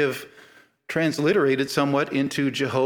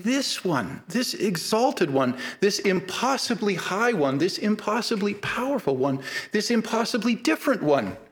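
rising higher with each phrase, this impossibly high one; building exaltation; 6 semitones higher than usual. Retold or imitated. David praising God, voice rising higher phrase by phrase. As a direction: climb in awe with each line. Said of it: "by saying".

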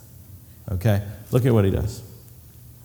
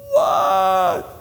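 distant shout; 4 semitones higher than usual. Acted. John answering the call.

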